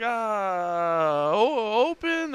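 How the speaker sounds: drawn-out, high-pitched tentative ask; halting language-barrier question. Retold or imitated. Asking bar staff who speak no English if they're open, halting. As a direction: stretch it out, rising high as you grope for the word.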